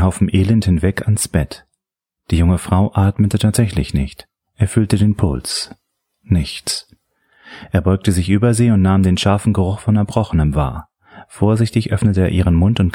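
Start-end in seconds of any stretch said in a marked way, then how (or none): none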